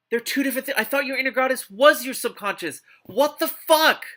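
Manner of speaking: high, loud disbelieving yell; incredulous shout. Real, not acted. Reader baffled by the inner goddess line, shouting in disbelief. Outraged at the book's logic.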